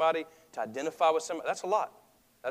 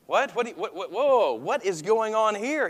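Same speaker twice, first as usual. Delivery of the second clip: slow, louder, higher startled whoa; mock astonishment; 6 semitones higher, 6 dB louder than usual. Preacher reacting to the story, in mock surprise. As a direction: play up the shock, drawn out.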